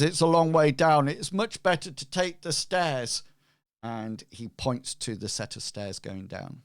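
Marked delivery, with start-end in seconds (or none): 0.0-3.2 raised, higher advisory voice; cautioning character, louder and pitched up; non-player character. Acted. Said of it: "He says".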